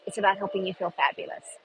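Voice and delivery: normal talking voice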